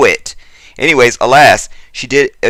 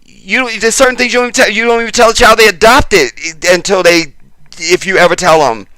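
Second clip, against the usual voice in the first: worked up; 8 semitones higher than usual. Real, not acted. Worked up ranting about adoption.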